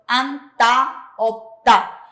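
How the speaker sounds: "anta, opta" with loud, separated final syllables; pronunciation demo. Shown as a demonstration. Teacher gives examples of tense ta, pronunciation demo. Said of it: "la ta suena ta".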